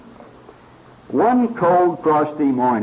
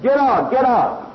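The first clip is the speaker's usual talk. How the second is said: high repeated mock yell; blacksmith shouting; 11 semitones higher than usual. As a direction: shoo him out twice, pitched way up. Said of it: "He said".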